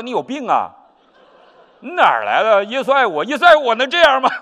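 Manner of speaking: high, loud and exasperated, cutting him off; indignant retort. Retold or imitated. Tenant scoffing at the pastor who keeps saying Jesus loves him, loud and exasperated.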